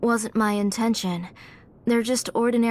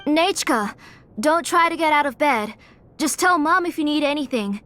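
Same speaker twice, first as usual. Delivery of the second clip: calling to her daughter; 5 semitones higher than usual. Acted. Mother calls across the house to her sick daughter. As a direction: call out gently but firmly across the house.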